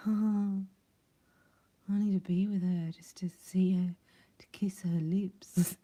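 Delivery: slow, breathy longing whisper; acted lovesick whisper. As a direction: whisper it slowly, aching to be near. Acting out his secret longing for her.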